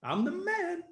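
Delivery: hammed-up cocky boasting, rising high; mock gloating over a small win; smug self-praise. Mocking people who pat themselves on the back, hammed-up smug boast. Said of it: "we pat ourselves on the back".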